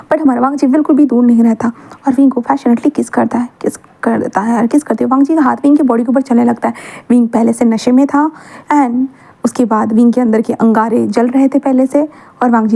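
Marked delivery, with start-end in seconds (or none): none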